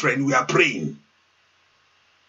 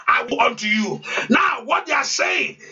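preaching at full force, chanting and calling out; fervent prayer; 7 semitones higher than usual. Acted. Pastor urging his congregation to pray aloud, preaching at full force.

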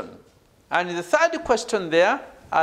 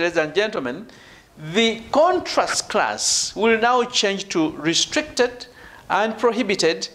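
ordinary talk, nothing marked

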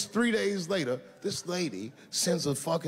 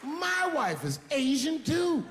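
high, slow, shouted reveal; gleeful gotcha; 12 semitones higher, slower than usual. Acted. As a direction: crow the surprise at her.

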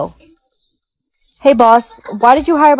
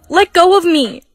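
frightened cry; 6 semitones higher than usual. Acted. Mother grabbed by abusive boyfriend.

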